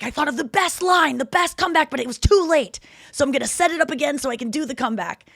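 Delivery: high-pitched, urgent retelling of George; excited scheming. Retold or imitated. George telling Jerry his comeback, excited. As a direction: blurt out the plan, high and eager.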